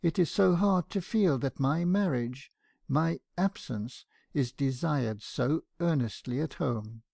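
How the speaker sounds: plain reading voice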